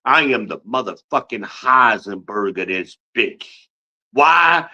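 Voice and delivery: ordinary talk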